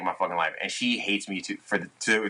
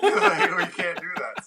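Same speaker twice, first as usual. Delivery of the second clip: squeaky laugh through the words; high laughing squeak; 9 semitones higher than usual. Real, not acted. Laughing at absurdity.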